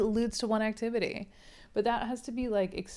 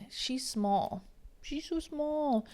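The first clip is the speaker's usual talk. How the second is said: sarcastically overstressed SMALL, drawn out and rising high; milk the word small, slow and squeaky-high; exaggerated slow stress on small; mock emphasis; 6 semitones higher, slower than usual. Shown as a demonstration. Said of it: "Get a little emphasis on the physicality".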